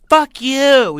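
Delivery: very high, loud mock yell; shrieky high impersonated outburst; retold heckling lady. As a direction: imitate a woman yelling in falsetto. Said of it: "one of the ladies is like".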